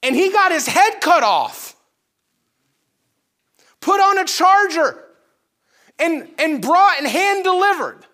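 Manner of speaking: high, loud, rising alarm; a frightened disciple crying out high and loud; panicked disciples. Retold or imitated. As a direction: voice their terror of being next. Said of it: "They're sitting there thinking".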